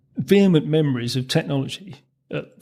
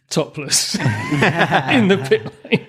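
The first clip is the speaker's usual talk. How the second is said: laughing; 5 semitones higher, much slower than usual. Real, not acted. Laughing at the topless girls story.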